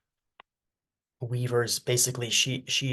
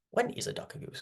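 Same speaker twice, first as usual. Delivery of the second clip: the riddle again in a much higher, hushed whisper; high whispered repeat; 13 semitones higher, 8 dB quieter, faster than usual. Shown as a demonstration. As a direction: breathe "When is a duck a goose?" way up high and softly, puzzled.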